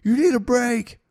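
put-on high, loud tipsy girl voice; drunk girl voice. He mimics the drunk girl calling to him, high and loud. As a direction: call down like a tipsy fan. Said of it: "she said something like".

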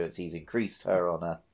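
normal talking voice

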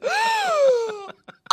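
high, loud mock gasp of horror; imitated shocked gasp. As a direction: gasp like a scandalised coworker. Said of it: "And she was like".

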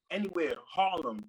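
usual speaking voice

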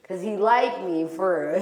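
ordinary talk, nothing marked

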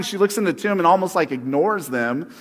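ordinary talk, nothing marked

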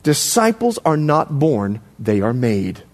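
low, slow and deliberate; slow dictation. Shown as a demonstration. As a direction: spell out "Disciples are not born" slowly for note-takers. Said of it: "I want you to write this down".

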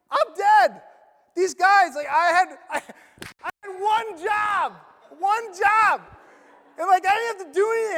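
high, loud panicked rant; panicked jailer. Acted. Preacher voicing the panicking jailer, high frantic outburst. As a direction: blurt it out like a guard who knows he's finished.

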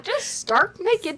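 drawn-out announcement, high and loud; slow, showy entrance line; mock naked arrival. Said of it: "You just made it sound like some people arrive".

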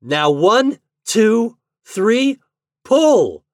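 rising, loud count to a command; countdown call. A narrator's reading. Boy leads a countdown.

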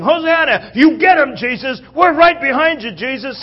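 high, loud shouts of support; noisy cheering crowd. Acted. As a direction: cheer him on from the throng. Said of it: "All the noise in the crowd, everybody's saying".

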